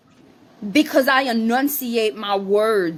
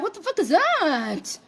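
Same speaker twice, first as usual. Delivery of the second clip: baffled; 6 semitones higher, 4 dB quieter than usual. Real, not acted. Reacting to a mocked comment.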